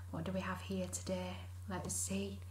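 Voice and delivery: quick hushed whisper. Acted. Whispering to the children before the reveal. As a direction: lean in and whisper.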